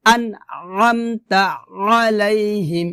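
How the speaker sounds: throat-squeezed onsets in "আমতা" and "আলাইহিম"; pressed ayn. Shown as a demonstration. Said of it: "আইন উচ্চারণ করতে হবে".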